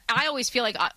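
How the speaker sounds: normal talking voice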